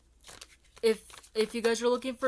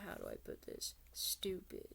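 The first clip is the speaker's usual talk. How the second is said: conspiratorial whisper; 3 semitones lower, 14 dB quieter than usual. Real, not acted. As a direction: whisper it like a secret. Whispered aside calling people stupid.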